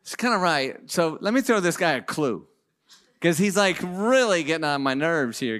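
ordinary talk, nothing marked

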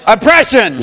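high, loud proclamation breaking into laughter; booming voice. Retold or imitated. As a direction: boom out the forgotten word, amused. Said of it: "This is a booming voice".

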